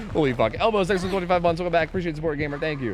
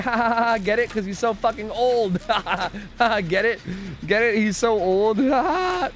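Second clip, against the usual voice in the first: mocking laugh; 6 semitones higher than usual. Mocking a chatter for being old.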